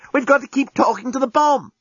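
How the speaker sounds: high-pitched exasperated urgency; urgent insistence. Acted. Lucy urging the alien to help, high and insistent.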